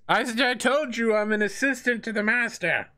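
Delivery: put-on high, huffy old man voice; indignant old assistant. The pinned old assistant answers the dwarf.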